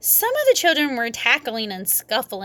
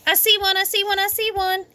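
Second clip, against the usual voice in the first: high, eager cry; excited shout; 10 semitones higher than usual. A narrator's reading. Sheldon shouting through a palm, high excited cry. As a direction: spot the egg again. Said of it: "he shouted".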